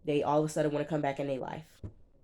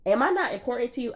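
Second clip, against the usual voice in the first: higher, fast pleading imitation; mock whine; 7 semitones higher, 4 dB louder, faster than usual. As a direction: plead like a needy girlfriend. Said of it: "she was like".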